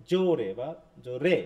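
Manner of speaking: slow জোরে stressed on জো, then জোরে stressed on রে; first জোরে falls from a strong জো, second lands hard on রে; contrasting syllable stress. Shown as a demonstration. Teacher contrasting two accents for students, slow shifting stress in জোরে বা জোরে. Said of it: "কোন সিলেবলটার উপর বেশি force দিচ্ছ".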